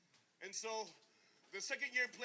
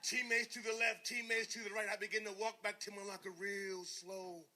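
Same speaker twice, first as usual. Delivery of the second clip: ordinary talk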